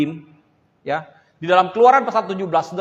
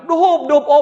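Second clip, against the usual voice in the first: much higher, louder comic cry; mock yell; 8 semitones higher, 7 dB louder than usual. Drowning man finally yelling in the local way. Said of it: "Waktu dia teriak".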